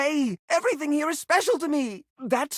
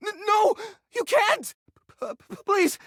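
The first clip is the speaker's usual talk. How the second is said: panicked pleading; 9 semitones higher than usual. Acted. Begs not to throw things away.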